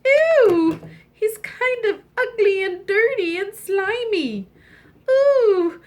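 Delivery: high, loud squeal of disgust; disgusted princess. Acted. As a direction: recoil from something slimy.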